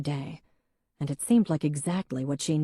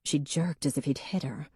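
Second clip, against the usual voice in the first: ordinary delivery, nothing marked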